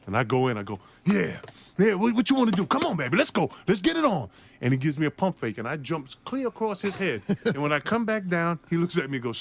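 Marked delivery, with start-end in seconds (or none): 1.0-4.3 high, pumped-up taunting; cocky trash talk. Retold or imitated. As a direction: hype yourself up and dare him. Rookie trash-talks Larry Bird.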